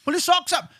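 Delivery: high, fast mock tough-guy jeering; mocking hard man. Mocking the old-school man who says get a job, high jeer. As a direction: sneer like a working-class dad. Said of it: "it's always been like".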